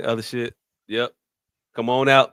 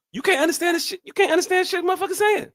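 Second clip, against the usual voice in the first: exasperated high voice. Real, not acted. Exasperated about Louisiana accents.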